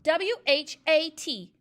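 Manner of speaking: loud, high, slow panicked spelling; squealed. A narrator's reading. Eric squeals the spelling while dangling by his ears. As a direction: squeal the letters out fast to be freed. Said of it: "he squealed".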